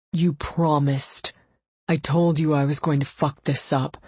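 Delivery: strained male voice, hushed start building in intensity; anguished declaration of love; desperate pleading lover. A narrator's reading. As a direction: plead as if breaking apart, drawing out key words. Desperate lover pleads, pained noise follows.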